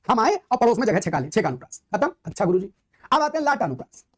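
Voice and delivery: usual speaking voice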